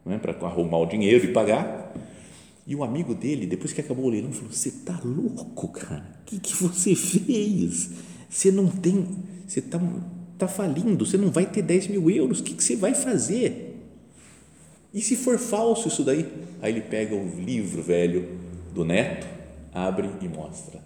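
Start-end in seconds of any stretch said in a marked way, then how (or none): 4.5-16.3 appalled friend imitation. Friend scolding the old man after the auction, high-pitched and appalled. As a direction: imitate a friend aghast at reckless folly.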